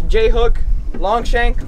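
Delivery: ordinary delivery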